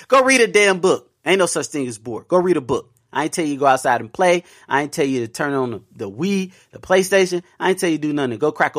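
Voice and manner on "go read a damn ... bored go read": stern parental order. Retold or imitated. Repeating order to kids.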